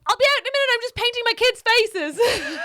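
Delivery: high, very loud imitation of the instructor. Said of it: "and the guy's like".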